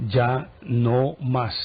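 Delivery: very slow, word by word; firm final refusal. Retold or imitated. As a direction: space out each word as a retold ultimatum. Patron's final refusal, retold.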